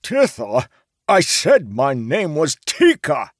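high, loud indignant correction; slow down and hammer it angrily; raging. A narrator's reading. Merchant corrects his name, raging. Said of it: "Tika raged".

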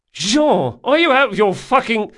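high, loud startled outrage; outraged shout. A narrator's reading. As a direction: yell indignantly at your friend. Doused man shouts in outrage.

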